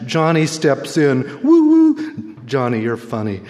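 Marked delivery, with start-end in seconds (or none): 1.4-2.4 excited whoop. Acted. Cheering line in poem.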